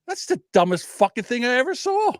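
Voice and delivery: high-pitched exasperated comeback; retold argument. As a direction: argue back in disbelief, acting it out. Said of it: "him and I are nose to nose spitting".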